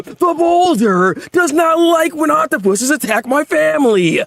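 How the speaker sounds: high, slow, hammed-up character voice; the Boulder. Reader jokes as the Boulder defending family, hammed-up voice. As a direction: draw it out in a put-on, self-important voice. Mock character voice for manga scene.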